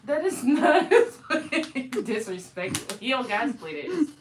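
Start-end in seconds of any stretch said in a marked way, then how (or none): 0.1-2.1 slow, louder, laughing through it. Real, not acted. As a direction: protest while laughing. Laughing protest.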